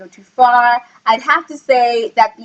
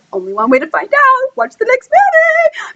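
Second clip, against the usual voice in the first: rising to a very high, playful pitch; mock promo singsong; 13 semitones higher than usual. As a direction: tease the sequel in a high, stretched-out voice. Jokey promotional sign-off.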